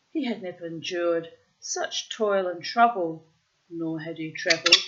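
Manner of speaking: put-on loud, deliberate read-aloud of an old tale; storybook reading. A narrator's reading. Reading an old tale aloud to the bird, deliberate storyteller voice. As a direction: read it out like an Arabian Nights storyteller.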